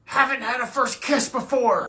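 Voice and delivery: ordinary talk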